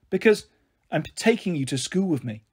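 usual speaking voice

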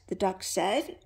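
plain reading voice

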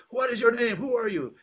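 calling out like the blinded giant, half-acted; retold shout. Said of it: "is shouting".

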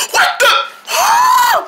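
shocked. Acted. Shocked again.

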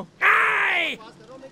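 high, loud, slow outraged shout; offended shout. Acted. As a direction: yell back, stung by the insult. Interviewee offended in a quarrel. (नाराज़ होकर ऊँची धीमी चीख)

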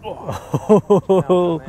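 ordinary talk

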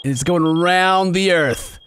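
usual speaking voice

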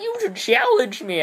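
high squeaky challenge; mock character voice. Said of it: "And then he's like".

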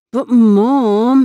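high, loud whine; whined. Acted. Boy whining at his mother, high loud whine. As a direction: whine the protest at mom. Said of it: "Humpy whined".

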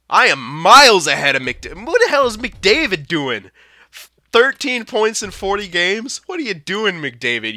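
loud, high disbelief at a rival's numbers; incredulous outburst. Real, not acted. Gamer shouting in disbelief at McDavid's stats. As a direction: yell it out in mocking disbelief.

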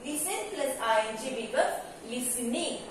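ordinary delivery

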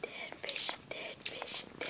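dread-filled whisper. Real, not acted. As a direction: breathe it under your breath. Dreading the next jelly bean.

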